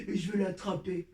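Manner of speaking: low menacing wolf voice; big bad wolf voice. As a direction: growl it like a storybook villain. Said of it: "prendre ma voix de grand méchant loup".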